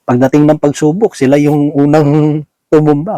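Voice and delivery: normal talking voice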